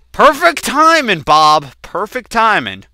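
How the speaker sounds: high, loud reaction to a scare; rattled exclamation. Real, not acted. Gamer to Bob after the scare, rattled exclamation. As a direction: call it out, still jolted.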